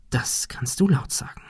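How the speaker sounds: soft unvoiced whisper; a conspiratorial whisper between friends. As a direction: breathe it as a secret aside. Silent glance between Thomas and Ben.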